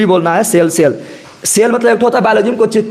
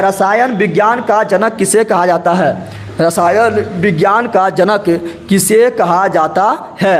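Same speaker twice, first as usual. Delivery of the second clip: slow, careful quiz question read to the class; slow dictation; slower than usual. Shown as a demonstration. Teacher slowly reads the quiz question to students. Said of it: "आप लोग भी जवाब दीजिए".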